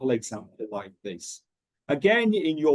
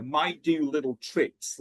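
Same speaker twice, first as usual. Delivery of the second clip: ordinary talk, nothing marked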